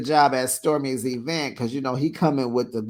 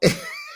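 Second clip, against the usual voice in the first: squeaky laugh. Real, not acted. High laugh.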